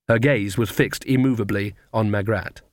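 plain reading voice

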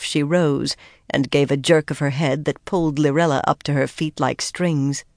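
usual speaking voice

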